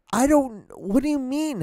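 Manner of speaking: a baffled, high-pitched what; incredulous. Real, not acted. Ranting about the Starbucks barista, incredulous and high. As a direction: pitch jumps way up in disbelief.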